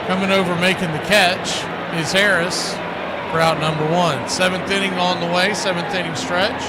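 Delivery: play-by-play call. Acted. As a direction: call the pop-up and the catch.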